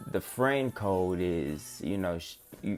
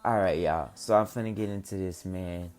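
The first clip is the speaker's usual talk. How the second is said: ordinary talk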